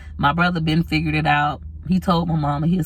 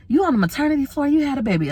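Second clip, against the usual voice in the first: high, fast mock surprise; surprised staff; 9 semitones higher, faster than usual. Hospital people on the maternity floor quizzing her, high and fast. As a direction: fire off the questions in disbelief. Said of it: "They was like".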